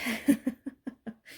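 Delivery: high breathy laugh at herself. Real, not acted. Vlogger laughing at her own story, high laugh. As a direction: let a real laugh escape.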